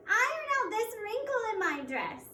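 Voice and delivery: high, loud demanding order; a high, loud spoiled-sister command; bossy stepsister. Acted.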